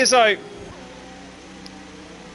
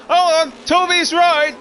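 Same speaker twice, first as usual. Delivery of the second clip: excited; 7 semitones higher than usual. Real, not acted. Sudden crash during race.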